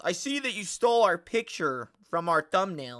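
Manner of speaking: a hint of a prissy accuser; lightly voiced accusing commenter; mock commenter. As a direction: read the comment in their voice. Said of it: "I had someone in my comments".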